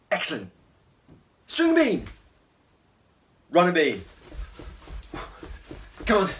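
loud, high excited calls; shouting game calls. Real, not acted. Leading an energetic kids' game.

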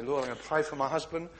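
hint of a chatty wife praying. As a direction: drift through a distracted prayer. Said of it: "I'm gonna be a wife here".

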